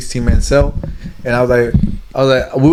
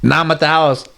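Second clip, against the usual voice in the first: usual speaking voice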